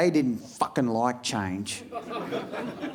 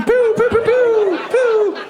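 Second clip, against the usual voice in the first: very high, loud pew pew blaster sounds; gun noises; 6 dB louder, slower than usual. Pretend. Comedian defending the fort from baddies, squeaky pew pew blasts. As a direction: fire a toy laser gun with your mouth. Shooting noises in pretend game.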